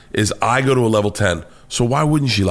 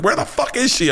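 imitated angry wife. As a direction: mimic an outraged wife demanding an answer, pitched way up. Wife angrily demanding, voiced in story.

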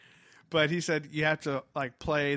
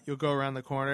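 ordinary delivery

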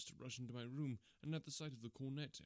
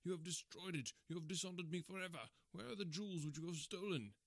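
raised-pitch furious accusation; beside himself with rage; 5 semitones higher than usual. A narrator's reading. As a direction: rage at a guilty son. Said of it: "beside myself with rage".

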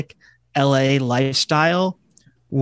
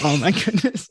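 ordinary talk

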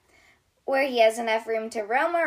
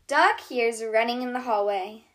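ordinary delivery, nothing marked

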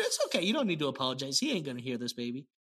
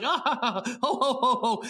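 jolly laughing; 5 semitones higher, slower than usual. Retold or imitated. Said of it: "And he's like".

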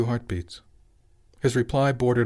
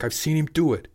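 raised, higher-pitched retort; shot back; 7 semitones higher than usual. A narrator's reading. Father insisting to wife about son, heated retort. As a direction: insist hotly. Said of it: "Richard shot back".

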